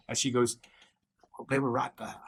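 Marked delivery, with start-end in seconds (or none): 1.4-2.2 quiet, half-whispered remark; hushed aside. Acted. NPC woman murmuring to the party about the blood.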